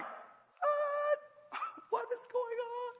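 very high squealing mock panic; terrified. Person in a boat seeing someone on water, mock terrified. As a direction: squeak it out in comic fright. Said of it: "they were terrified the same way".

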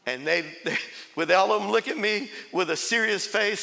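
usual speaking voice

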